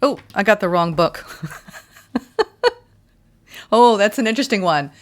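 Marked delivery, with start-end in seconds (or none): 3.7-4.9 surprised. Real, not acted. Pulls the wrong oracle book.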